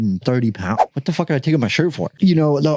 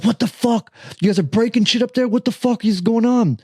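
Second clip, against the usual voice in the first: retold yell; 9 semitones higher than usual. Someone downstairs yelling up about breakage, mock yell. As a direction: imitate an indignant shout without full volume. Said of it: "Somebody from downstairs yells".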